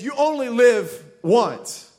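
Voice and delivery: slow, emphatic slogan read; slow spelled-out saying. Shown as a demonstration. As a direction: draw each word out slowly and deliberately.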